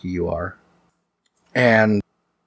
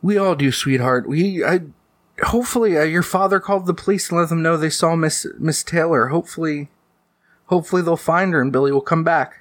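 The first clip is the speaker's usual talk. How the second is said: high-pitched mother voice; reassuring mom; 7 semitones higher, faster than usual. Acted. Mom comforting child who misses Billy, high reassuring voice.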